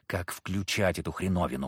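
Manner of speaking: low, soft hissed question. A narrator's reading. Hero asks Zakhar about the amulet, soft hiss. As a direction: hiss it urgently to the friend. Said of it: "прошипел я".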